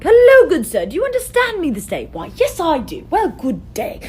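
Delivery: hammed-up courteous greeting, starting high and loud; overly proper exchange of pleasantries; mock formal gentleman. Two people chat in the one language, mock formal.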